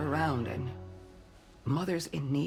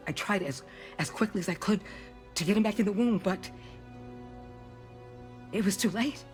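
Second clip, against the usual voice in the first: higher, halting, full of regret; grieving confession; 7 semitones higher, 4 dB quieter than usual. Acted. Doctor recalling a baby too late to save, grieving.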